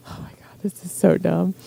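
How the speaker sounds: a private embarrassed whisper; whispered aside. Real, not acted. Speaker to herself about the silly story, whispering. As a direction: whisper it under your breath.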